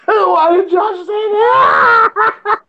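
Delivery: high, very slow laugh-cry; crying with laughter. Real, not acted. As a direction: squeal it out through tears of laughter. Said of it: "I'm crying".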